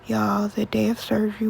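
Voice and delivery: normal talking voice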